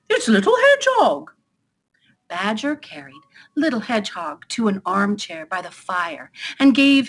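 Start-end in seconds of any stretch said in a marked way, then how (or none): 0.1-1.3 very high, loud astonished cry; surprised exclamation. Acted. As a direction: exclaim who has just rolled in. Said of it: "he said in surprise".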